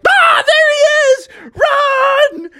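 high panicked shout. Acted. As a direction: yell it, scared and fleeing.

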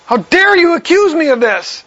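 mock-angry indignant Peter. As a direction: throw the accusation back, offended. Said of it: "He's angry now".

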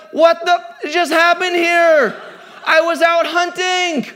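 high, loud mock outrage, stretched out; angry. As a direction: act out an indignant brother, slowly wailing the complaint. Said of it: "And he's angry".

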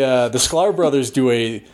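ordinary delivery

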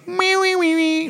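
very high, chirpy droid beeps. Retold or imitated. Droid waving goodbye to a fan, high chirpy beeps. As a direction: chirp it like a little robot saying bye. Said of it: "like a, like a bye".

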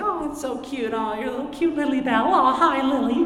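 very high, cutesy dog-talk voice; cutesy Mickey voice. Retold or imitated. Talking to his dog, cutesy high cartoon voice. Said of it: "talk to dogs in a cutesy voice".

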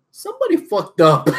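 amused call-out breaking into laughter; gleeful laughing. Retold or imitated. Viewer spotting the TV mistake, gleeful laughing. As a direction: announce it gleefully, then crack up.

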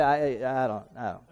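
low, fading mock mumble; sheepish stammer. Pastor playing a member who forgot the message, sheepish stammer. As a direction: imitate someone fumbling for an answer, trailing off low and soft. Listener stumbling for an answer.

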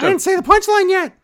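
high, loud whiny complaint; hammed-up high protest; mocking King. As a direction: whine like a cheated comedian. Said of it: "King's like".

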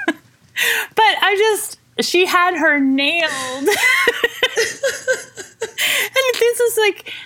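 high, drawn-out words breaking into laughter; real laughing hard. Real, not acted. Mom cracking up at her daughter's impression, laughing hard.

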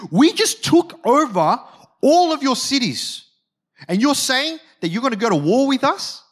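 high-pitched incredulous mockery; mocking. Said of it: "he's mocking Hezekiah".

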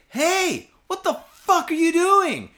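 high, loud challenge with a drawn-out ending; retold angry shout. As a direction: re-enact yelling at a thief caught red-handed. Calls out to a shoplifter.

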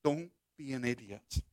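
low, hushed tempter's warning; soft, sneaky dissuasion; insidious whisper. Acted. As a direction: murmur it softly into their ear. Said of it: "Satan starts whispering".

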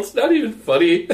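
high, loud laughing protest; helpless laugh breaking into the words. Real, not acted. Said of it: "he's laughing at his own joke".

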